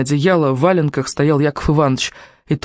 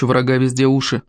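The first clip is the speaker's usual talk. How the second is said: ordinary delivery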